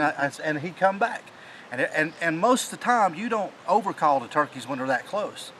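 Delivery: ordinary talk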